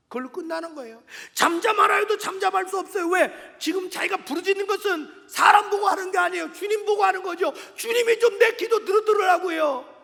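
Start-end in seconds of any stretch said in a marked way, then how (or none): 1.3-10.0 loud, higher pulpit shout; impassioned, insistent preacher; fervent preaching. Real, not acted. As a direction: challenge the congregation at full voice. Preaches at full force.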